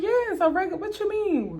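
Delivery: high, loud indignant comeback; defensive protest. Retold or imitated. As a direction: protest as if wrongly accused. Acted defensive reply to sister.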